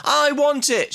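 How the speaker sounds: mild mock scream of a wanting kid. Little girl in toy store, mock screamed demand. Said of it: "she screams like a kid".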